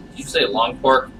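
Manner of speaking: usual speaking voice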